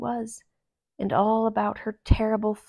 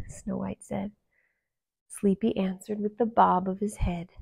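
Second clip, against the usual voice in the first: ordinary delivery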